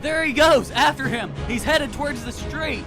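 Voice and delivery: high-pitched chase shout; excited high holler after a runaway; police shouts. Acted. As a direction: call out urgently to fellow officers.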